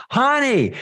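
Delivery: high, drawn-out sing-song holler; calling down a well. Acted. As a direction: call cheerily from far above. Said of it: "you look down and you go".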